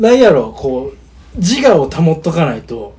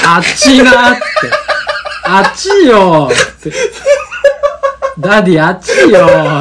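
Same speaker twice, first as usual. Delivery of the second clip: whiny complaint; 8 semitones higher, 6 dB louder than usual. Retold or imitated. Complaining child quoted.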